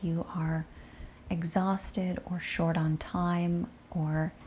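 soft, unhurried body-scan voice with long settling pauses; guided meditation. Acted. Yoga teacher guiding students to sink into shavasana, soft meditation voice.

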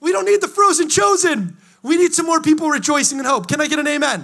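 high, loud rallying shout; fired-up preaching. Real, not acted. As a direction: drive it home to win an amen. Sermon climax calling for amen.

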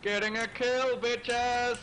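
high, loud gloating cry; triumphant shout. Real, not acted. Player boasts to the team of a kill, triumphant shout. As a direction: crow over your kill.

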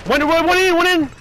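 high, loud alarmed callout; alarmed shout. Real, not acted. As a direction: yell the alert. Enemy entering, alarm.

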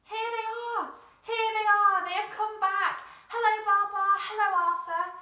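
high, loud excited shouts; joyful shouting crowd. A narrator's reading. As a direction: cheer them home at full voice. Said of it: "running and shouting".

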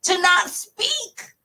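high, loud anguished protest; pleading outburst at the Lord; upset plea to God. Retold or imitated. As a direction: cry out to God in frustration. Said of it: "I was pissed. I said".

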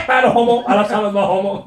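mock defensive. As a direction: parody a touchy guy. Mocking friend's defensiveness. (pilkkaava puolustelu)

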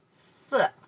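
ordinary talk, nothing marked

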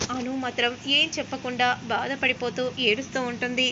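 ordinary delivery

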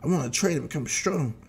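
acted whiny little boy; whining kid. Child Goten complains about school.

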